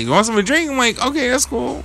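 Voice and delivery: high, sing-song imitation of host and guest; mock polite exchange. Voicing offer and reply.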